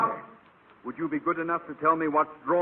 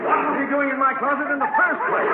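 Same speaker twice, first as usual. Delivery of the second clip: sudden outburst; 14 semitones higher, 7 dB louder than usual. Acted. Principal yells at the closet, suddenly suspicious. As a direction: blow up as the penny drops. Conklin realizes, explodes.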